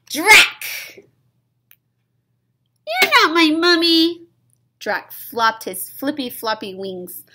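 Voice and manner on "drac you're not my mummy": shouting. Acted.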